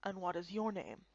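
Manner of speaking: low, soft, grave elder voice; solemn old Kappa. Acted. Old Kappa elder welcoming foreigners, low and soft. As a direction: speak like an ancient guardian of sacred lands.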